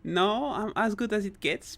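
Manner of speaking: ordinary delivery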